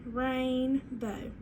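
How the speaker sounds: "Rainbow" drawn out as RAIN... BOW; slow two-part "Rainbow" for a class to follow; stretched into syllables. Shown as a demonstration. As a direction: pull "Rainbow" apart, rain, then bow. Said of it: "stretch it out as we say our part".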